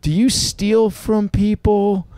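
put-on high, slow little boy asking innocently; little kid voice. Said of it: "And he just goes".

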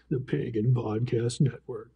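low, slow, sinister announcer; sinister deep voice. Retold or imitated. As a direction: growl it out like an ominous radio ad. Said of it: "real sinister, deep voice".

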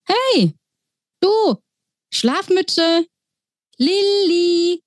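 high mouse calling up to a window. Acted. Mouse pilot calls up to sleepy Lilly's window. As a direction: call up to the sleepy girl, pitched high.

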